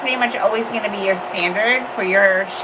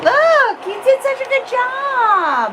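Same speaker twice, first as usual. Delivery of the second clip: high, excited praise; 4 dB louder, slower than usual. Real, not acted. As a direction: gush over their work. Praising a first nail.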